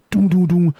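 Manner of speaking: loud, high mock door knocks; knocking sound. Vlogger imitating SpongeBob pounding on the door, loud knocks. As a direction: bang it out like fists on a door.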